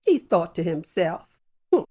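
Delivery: usual speaking voice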